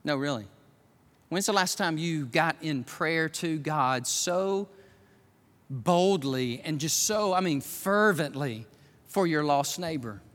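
low, softened preacher's voice; hushed, searching preaching. Acted. Preacher questioning church about sharing the gospel, hushed. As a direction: drop low and put it to them personally.

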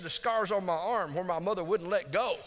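high, loud, emphatic punchline; proud declaration. Retold or imitated. Rescued boy telling the TV anchor about his mother, proudly. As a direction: build it up as a boy's proud boast.

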